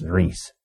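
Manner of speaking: "Rhys" said like "Reece"; Welsh pronunciation. Shown as a demonstration. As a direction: say "Rhys" with a long ee. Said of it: "but it's, it's".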